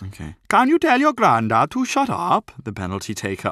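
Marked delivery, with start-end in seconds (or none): 0.5-2.5 high Welsh voice, toned-down shout; calm the Welsh down but keep it high; thick Welsh accent. Acted. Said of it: "He said in a thick Welsh accent".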